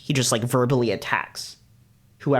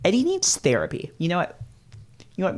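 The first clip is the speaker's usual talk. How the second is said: usual speaking voice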